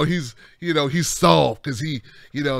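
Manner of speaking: ordinary talk, nothing marked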